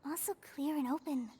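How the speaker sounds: low, soft murmur, almost a whisper; hushed aside. Acted. Paimon murmurs privately about the dancer, hushed whisper. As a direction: muse quietly to yourself, half-whispering.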